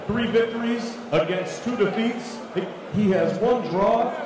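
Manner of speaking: ordinary talk, nothing marked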